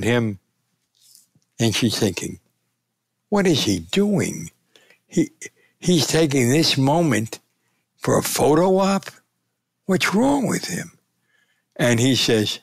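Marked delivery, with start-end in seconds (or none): 3.3-11.0 mock disbelief, rising higher toward the end; retold indignant thought, climbing in pitch; incredulous. Daughter silently questioning a man at the podium, incredulous.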